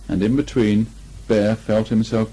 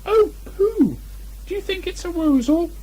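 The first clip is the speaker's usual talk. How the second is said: very high, squeaky Piglet; excited squeak. A narrator's reading. Piglet spotting paw marks with Pooh, squeaky and excited. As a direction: squeal it like a thrilled little piglet. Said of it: "a little squeak of excitement".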